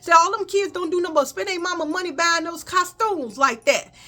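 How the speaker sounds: high preachy mom. Retold or imitated. Mom lecturing about Halloween.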